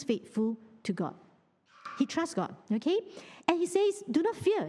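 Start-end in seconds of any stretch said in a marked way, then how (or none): none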